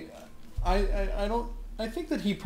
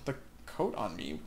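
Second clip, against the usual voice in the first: low, quiet disbelieving echo; incredulous repeat; 9 semitones lower, 6 dB quieter than usual. Real, not acted. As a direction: repeat the phrase as if it makes no sense. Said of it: "The fuck?".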